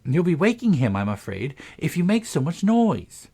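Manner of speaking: put-on high, urgent girl's hush; high-pitched anxious shushing. A narrator's reading. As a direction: hush them in a high, worried voice. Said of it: "Alice couldn't help saying".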